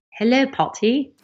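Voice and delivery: high-pitched mock English greeting; playful imitation of an English accent. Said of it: "I did the English accent".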